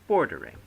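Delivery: "bordering" with a high, loud "bor", two slack syllables after; stress on first syllable. Shown as a demonstration. Teacher giving a dactyl example, "bordering" stressed hard on its first syllable. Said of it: "one stressed followed by two slack".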